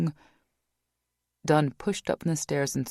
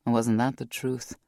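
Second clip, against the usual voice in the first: ordinary delivery, nothing marked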